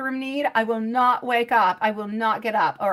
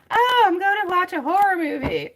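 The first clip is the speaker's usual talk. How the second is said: a lightly higher, airy mock voice of the friend; imitating a friend; 9 semitones higher, 5 dB louder than usual. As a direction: play the friend casually announcing a horror movie, a bit higher. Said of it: "I don't know why I talk like that".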